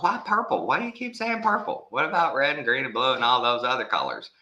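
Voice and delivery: high-pitched teasing disbelief throughout; mock baffled high voice. Grandma teasing her granddaughter's purple obsession, high mock bafflement. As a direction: squeak out playful puzzlement at a toddler. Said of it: "And I'm like".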